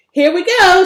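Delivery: loud, high excited shout; a girl yelling with glee as the rover takes off. Acted. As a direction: whoop it out over the bumpy ride. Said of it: "she shouted".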